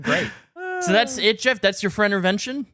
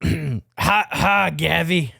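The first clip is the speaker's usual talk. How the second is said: bad grandpa voice; much slower than usual. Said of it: "That was terrible".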